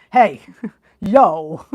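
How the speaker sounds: loud, low tough-guy Jersey shout; tough Jersey talk. Acted. Showing southerners how we sound tough, mock-tough Jersey accent. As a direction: bark it like a tough northerner, laughing. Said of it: "we always sound tough".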